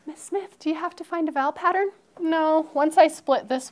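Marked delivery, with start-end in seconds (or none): 0.1-1.9 lightly voiced child, high and fast; mock kid voice. Teacher imitating a student's question, higher and faster. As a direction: ask like a curious kid. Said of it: "Some kids might say".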